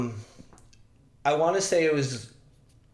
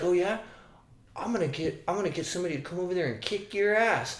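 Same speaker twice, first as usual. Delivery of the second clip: mock threat; 6 semitones higher, 4 dB quieter, faster than usual. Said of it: "he's like".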